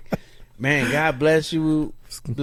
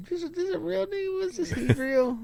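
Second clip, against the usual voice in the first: high, hushed inner voice; sizing someone up; 6 dB quieter than usual. Retold or imitated. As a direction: wonder it softly to yourself in a high pitch. Voicing his silent sizing-up.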